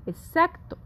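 ordinary delivery

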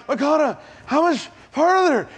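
high, strained, winded plea; out of breath plea. Retold or imitated. Tired pastor begging his friend how much farther, winded and high. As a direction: gasp it out as if paddling forever.

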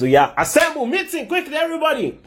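rising to a high-pitched call; rally everyone for an emergency meeting; urgent summons. Acted. God calls heaven to a meeting, urgent summons. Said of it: "And then he says".

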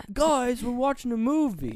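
high, loud imitated whine of protest; retold loud protest. Retold drunk friend protests loudly.

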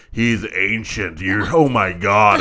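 loud, low, dragged-out comic dismay; slow, hammed-up incredulity; mock disbelief. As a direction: stretch the words in mock horror. Imitated disbelief at player's age.

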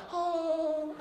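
high, slow drawn-out "哦", swooning at a handsome guy; swooning girls' coo. Retold or imitated. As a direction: coo like girls seeing a kitten. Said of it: "少女见到猫的反应".

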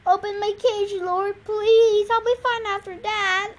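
high, insistent plea; pleading. A narrator's reading. Zebra begs to be let out.

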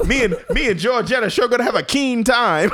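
put-on giddy old-timey teen; 1950s teen voice.

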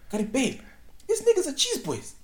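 high, excited announcement to wife; excited telling. Retold or imitated. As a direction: burst out with the news. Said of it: "I'm like".

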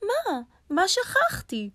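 very high, drawn-out rabbit question; puzzled rabbit. A narrator's reading. Rabbit asks lion what he forgot, high and puzzled. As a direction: ask high and slowly, baffled.

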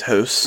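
house said like hoose, Canadian vowel. Retold or imitated. Mocking a Canadian real estate agent's house. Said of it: "every time she says the word house".

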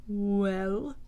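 low rumbling earth-deep voice, lightly done; a slow, heavy question from a mighty figure; deep, powerful villain. A narrator's reading. Shadowed man in the chair prompting his underling, deep and strong. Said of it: "voice was just like ... deeper and stronger".

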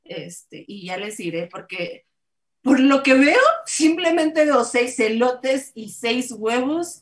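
ordinary talk, nothing marked

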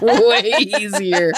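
slow words through laughter; laughing. Real, not acted. Agreeing with former roommate she was easier, laughing. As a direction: draw it out while cracking up.

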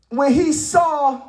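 normal talking voice

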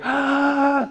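sudden high shriek of panic; panicked shriek. Retold or imitated. Mary freezing in panic at the hairball, high shriek. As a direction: shriek like you froze in fright.

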